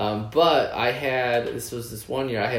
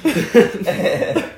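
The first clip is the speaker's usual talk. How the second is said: laughing hard while telling it; can barely get words out for laughing; 8 semitones higher, 10 dB louder, faster than usual. Real, not acted. Laughing while telling a friend about hiding in the garage.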